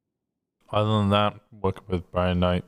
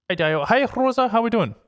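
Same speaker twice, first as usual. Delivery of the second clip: bright, high sing-song hellos; cheery greeting; 14 semitones higher, 4 dB louder than usual. Real, not acted. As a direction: greet them all brightly.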